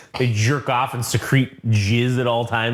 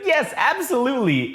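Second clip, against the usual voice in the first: high squeaky alien; 11 semitones higher than usual. Retold or imitated. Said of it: "And he's like".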